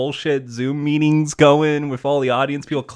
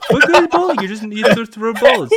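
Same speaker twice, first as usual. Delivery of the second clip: Trump impression; 5 semitones higher, 5 dB louder, faster than usual. Mock Trump rally rant.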